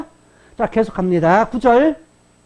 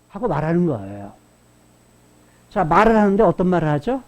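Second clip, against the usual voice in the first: usual speaking voice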